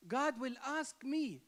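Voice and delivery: normal talking voice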